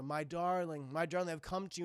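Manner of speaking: low, soft vampire suitor; Michael Morbius. Acted. Vampire calling to sleeping woman, soft seduction. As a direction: croon it low and softly. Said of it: "the voice of Michael Morbius calling to her".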